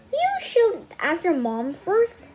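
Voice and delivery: slow caution. Acted. Bean cautioning Ivy.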